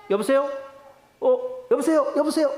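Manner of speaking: acted confusion on a dropped call; puzzled phone hello. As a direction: check if the caller is still there. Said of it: "갑자기 상대방 목소리가 안 들려".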